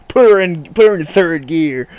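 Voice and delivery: loud, punchy "put her in"; mock eager driver. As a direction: hype it up like a reckless driver. Mocking driver's eager start.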